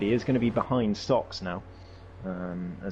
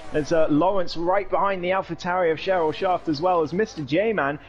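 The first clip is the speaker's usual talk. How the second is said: fast lively call of the chaos; motorsport commentator; 8 semitones higher than usual. Acted. Crash and restart call.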